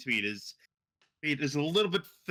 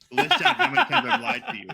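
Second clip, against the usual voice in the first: high, loud and fast through laughter; laughing indignant demand; 12 semitones higher, 9 dB louder, faster than usual. Retold or imitated. Friend Hound demanding proof of lies, laughing high and loud. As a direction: fire it back, cracking up. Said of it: "looks at me".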